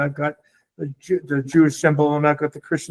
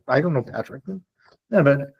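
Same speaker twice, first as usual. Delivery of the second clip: ordinary delivery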